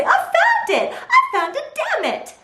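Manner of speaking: very high, laughing squeal; shrill gleeful little-girl voice; squealing toddler. Retold or imitated. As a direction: squeal like a delighted two-year-old. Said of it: "I hear her squeal and say".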